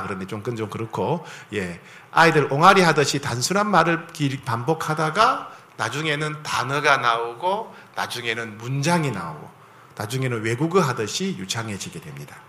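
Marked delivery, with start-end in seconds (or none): none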